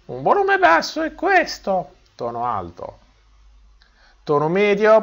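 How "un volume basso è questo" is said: higher and quieter; high pitch, soft. Shown as a demonstration. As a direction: keep pitch up but drop the volume.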